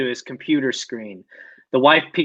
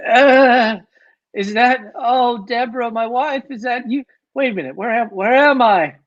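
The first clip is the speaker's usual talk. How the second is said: dazed waking voice; 9 semitones higher than usual. Acted.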